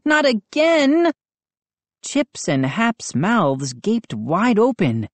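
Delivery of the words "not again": high and slow, drawn out in dismay; boy horrified the gnomes changed again; dismayed cry. A narrator's reading. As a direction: stretch it out in alarm. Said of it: "I cried".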